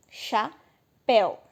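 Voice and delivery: "Chapéu" split slowly into cha and péu; slow syllable split of "Chapéu"; two syllables. Shown as a demonstration. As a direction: say "Chapéu" in two separate beats. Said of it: "Tem duas sílabas".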